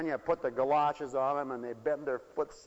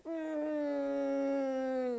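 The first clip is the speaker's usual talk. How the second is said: high, drawn-out whiny hum; whiny child's fuss; 10 semitones higher than usual. Retold or imitated. Parent mimics child resisting the snowsuit, high whine. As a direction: imitate a cranky kid straining, high and long.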